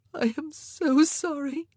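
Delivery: high, slow tearful apology; sobbing. A narrator's reading. Queen apologising to the king for the dead son, sobbing. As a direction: apologise through sobs. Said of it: "she sobbed".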